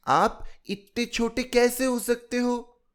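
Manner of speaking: put-on high, disbelieving little girl; incredulous child. A narrator's reading. Chilki doubting the grown-up was ever small, high child voice. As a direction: ask it like a doubting six-year-old, high.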